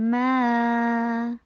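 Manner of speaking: long recited ما; held long vowel. Shown as a demonstration. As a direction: hold the "aa" of ما. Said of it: "time لگائیے".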